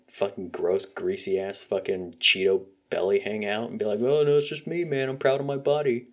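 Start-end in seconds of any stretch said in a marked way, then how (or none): 4.0-6.1 raised, loud mocking tone of a smug shopper; mock proud voice. Ranting vlogger mocks a proud shopper, higher and louder. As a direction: parody the shopper's cheerful pride.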